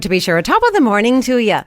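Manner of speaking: playful stage Irish lilt; hammed-up Irish accent. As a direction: put on your best stock Irish accent. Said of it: "give us your best Irish accent".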